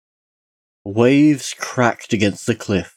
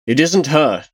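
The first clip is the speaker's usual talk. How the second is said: raised pitch, mildly heated insistence; a touch of angry protest; understated thundering; 6 semitones higher, 3 dB louder than usual. Acted. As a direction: thunder it with restraint. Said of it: "Tarsia thundered".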